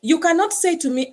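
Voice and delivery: ordinary talk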